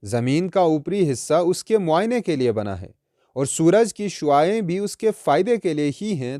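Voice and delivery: normal talking voice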